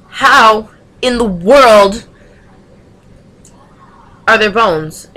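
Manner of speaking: slow, drawn-out and loud disbelief; exasperated drawn-out how in the world; incredulous. Real, not acted. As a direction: stretch each word out like it's unbelievable.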